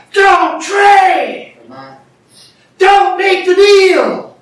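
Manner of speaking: high, loud preacher's shout; exhorting yell. Shown as a demonstration. Believers warning one going back to law, urgent yell. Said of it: "it's up to you to yell".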